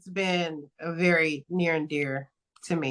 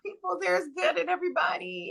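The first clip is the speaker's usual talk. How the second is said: naive voice; 13 semitones higher, 4 dB quieter than usual. Retold or imitated. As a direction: gush it like a starry-eyed dreamer. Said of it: "that little bit of naive".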